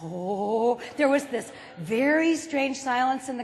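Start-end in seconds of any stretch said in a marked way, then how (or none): none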